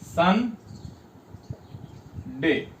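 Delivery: "सन" and "डे" as two separate beats with a long pause between; teacher slowly separating "सन" from "डे"; syllable by syllable. Shown as a demonstration. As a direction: break "Sunday" into "सन", pause, "डे". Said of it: "देखिए इसको कैसे बोलते हैं".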